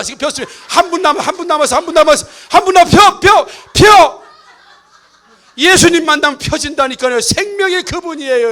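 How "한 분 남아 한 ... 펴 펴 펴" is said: higher, louder coaxing cry; playful urging of the last person; urging shout. Real, not acted. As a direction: drag out 펴 pleading loudly. Urging congregation to smile.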